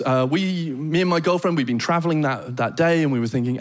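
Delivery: ordinary talk, nothing marked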